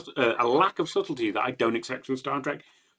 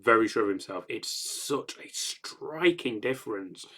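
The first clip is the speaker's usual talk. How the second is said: usual speaking voice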